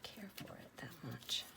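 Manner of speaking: hushed, breathy ASMR murmur; soft whisper. Acted. Crafter whispering to viewers while sorting stamps, soft whisper. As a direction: whisper it close to the mic.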